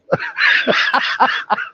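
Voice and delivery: high breathy laugh that falls away; real laugh. Real, not acted. Recalling the crowd's reaction, a high falling laugh. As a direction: crack up at the punchline. Laughing at his own story.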